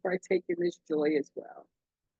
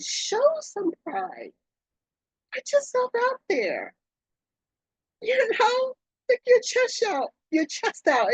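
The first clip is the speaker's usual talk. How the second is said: rousing urge; 13 semitones higher than usual. Real, not acted. Urging listeners to show pride.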